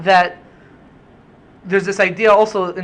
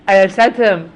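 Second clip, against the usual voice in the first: usual speaking voice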